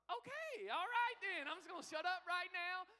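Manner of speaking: high-pitched, sheepish backing down; mock chastened. Pastor retelling his reaction to the trooper's warning, high mock-sheepish voice. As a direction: pitch it way up, comically giving in.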